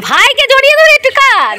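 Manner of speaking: a teasing shout urging her to cry. Real, not acted. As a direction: yell it over to her playfully. Teasing call to the crying woman.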